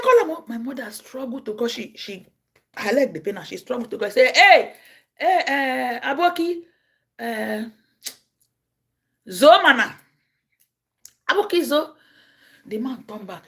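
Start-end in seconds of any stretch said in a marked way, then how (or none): 0.0-0.4 firm command. Retold or imitated. Commanding mother. 4.4-12.0 loud call after a man walking away. Real, not acted. Calling the beggar back.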